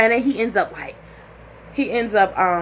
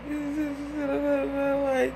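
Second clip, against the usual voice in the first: soft, high whimper; squeaky pretend weeping; mock crying; 7 semitones higher, 6 dB quieter than usual. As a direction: imitate sobbing through the words.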